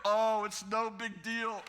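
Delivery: put-on forgiving voice; feigned nonchalance. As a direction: stretch it out, pretending all is fine. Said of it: "Inside, I'm like".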